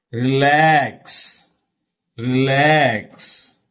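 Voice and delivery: "relax" as re-LAX, long falling LAX; stress on second syllable. Shown as a demonstration. Teacher demonstrates words with weak prefixes, LAX drawn out. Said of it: "stress fell on second syllable".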